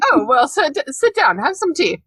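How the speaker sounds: put-on polite British boy. Podcaster imitating Harry offering tea, mock genteel. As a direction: offer tea with exaggerated manners. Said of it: "He's like".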